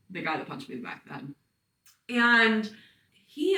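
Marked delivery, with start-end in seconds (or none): 0.1-1.3 rattled off fast and much softer, like a set nickname; the name everyone knows him by, muttered fast and low; quick tag line. Shown as a demonstration. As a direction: toss off his label as one quick, hushed phrase. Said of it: "that's how we identify him".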